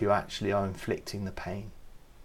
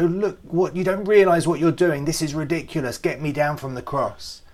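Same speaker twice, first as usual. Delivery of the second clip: louder, higher, exasperated protest; a hammed-up Jesus losing patience; mock indignant outburst; 5 semitones higher, 6 dB louder than usual. Jesus imagined complaining on the cross, exasperated. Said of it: "No, he says".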